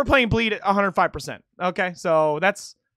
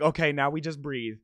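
lower, softer coaching to yourself; hushed, reassuring inner voice; acted self-soothing; 6 semitones lower, 4 dB quieter than usual. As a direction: talk yourself down calmly, as if mid-performance. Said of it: "where I'm just like".

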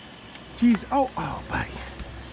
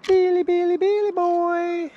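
much higher, sing-song call; high pet-calling voice; 7 semitones higher than usual. Real, not acted. Owner calling her pet Billy, high sing-song. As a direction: call him over in a bright, high lilt.

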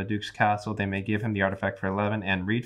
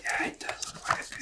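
whispered aside; 9 dB quieter than usual. Real, not acted. Muttered aside at the table.

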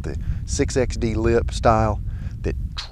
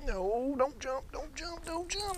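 pleading; 15 dB quieter, slower than usual. Real, not acted. Fish about to jump off.

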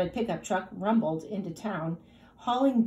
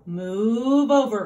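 slow, loud commanding cow voice; a slow, loud cow barking an order; bossy cow ordering; 6 dB louder, slower than usual. A narrator's reading. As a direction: boss the others aside like a pushy cow. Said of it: "ordered Cow".

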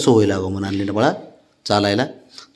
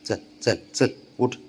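usual speaking voice

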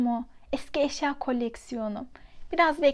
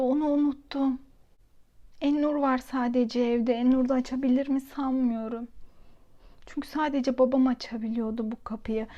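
usual speaking voice

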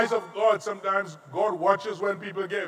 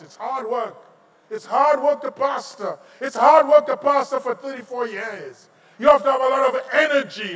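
rising from plain to loud; building emphasis; 4 semitones higher, 5 dB louder than usual. Acted. Pastor telling the church about his years, building to loud. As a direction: build it up to a shout.

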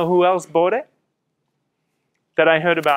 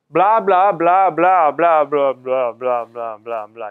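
dismissive blah-blah; 3 semitones lower, 4 dB louder, slower than usual. Retold or imitated. Reading out a long message to an audience, blahs fading away. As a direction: rattle off boring small print, fading out.

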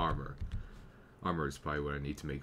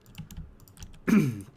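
loud rough throat clear; 8 semitones higher, 11 dB louder than usual. Real, not acted. Youtuber with a cold clears his throat mid-video. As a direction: clear a sick throat hard. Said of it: "Yeah, again, sorry. I just have a slight cold".

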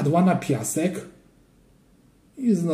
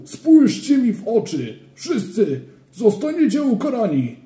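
higher, louder, stern scolding; furious supervisor; 8 semitones higher, 4 dB louder than usual. A narrator's reading. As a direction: dress them down like an angry school monitor. (surowy, gniewny wychowawca)

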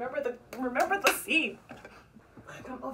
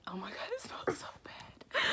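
whispering; 2 semitones lower, 4 dB quieter than usual. Real, not acted. Whispered reaction to smell.

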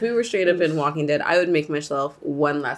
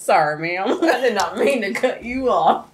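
southern twang; 3 semitones higher than usual. Pretend. Driver apologizes to another driver in a mock Southern accent. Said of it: "my redneck southerner comes out whenever I drive".

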